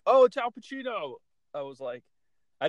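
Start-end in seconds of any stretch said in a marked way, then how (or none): 0.1-1.1 high, loud excited "oh"; excited recognition. Retold or imitated. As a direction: gasp of recognition. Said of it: "I wasn't like".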